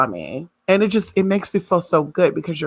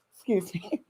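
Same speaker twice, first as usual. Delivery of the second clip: much softer, laughing excuse; quiet excuse me; 11 dB quieter than usual. Real, not acted. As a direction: hush it with a laugh. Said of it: "I got a little excited there".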